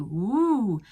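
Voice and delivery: long, slow sung ooh vowel; sustained ooh. Shown as a demonstration. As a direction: hold out a round ooh. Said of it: "rather than ooh".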